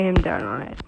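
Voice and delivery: unvoiced whispered correction. Real, not acted. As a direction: drop to a whisper for the fix. Correcting herself, then whispers.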